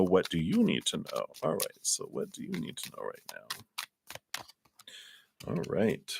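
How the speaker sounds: soft breathy whisper to herself. Real, not acted. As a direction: whisper the question under your breath. Whispers to herself while shuffling.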